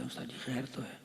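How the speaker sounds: hushed anxious plea; almost breathe it, worried and small; softly. Retold or imitated. Student quietly asks the stern teacher, hushed. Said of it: "آہستہ سے کہا".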